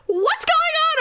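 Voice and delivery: high, mocking confused question; mock groggy husband. Imitating Daryl woken by a flashlight, high and alarmed. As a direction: play him dazed and alarmed. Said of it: "He goes".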